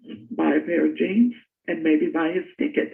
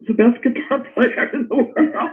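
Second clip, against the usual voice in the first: laughing; 7 semitones higher than usual. Real, not acted. Laughing at her own missed chance.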